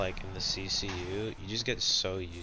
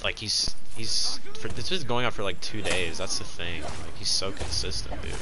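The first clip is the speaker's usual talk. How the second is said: loud, exasperated complaining; venting loudly about the troll; loud rant; 11 dB louder than usual. Real, not acted. Frustrated ranting about griefer.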